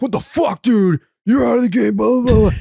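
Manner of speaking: high, loud mock umpire outburst; angry umpire. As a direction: play a fed-up umpire blowing up. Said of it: "umpires in the union right now are being like".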